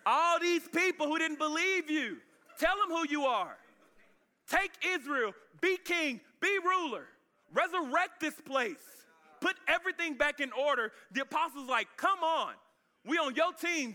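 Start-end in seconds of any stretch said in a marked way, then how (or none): 0.1-10.9 high, drawn-out rallying cry; fired-up follower, voice raised high; triumphant urging. Acted. Acting disciples urging Jesus to take power. 12.0-13.9 high, rallying plea; fired-up team supporter; eager cheering. Acted. Acting apostles cheering Jesus on.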